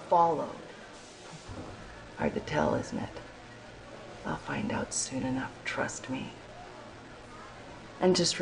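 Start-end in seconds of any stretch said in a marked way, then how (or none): 2.2-6.3 menacing whisper. Acted. Soldier threatening trooper in bar.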